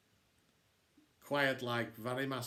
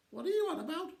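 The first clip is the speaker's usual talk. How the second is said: much higher, baffled question; incredulous; faster than usual. Acted. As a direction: cannot believe what he said. Florida baffled at dad.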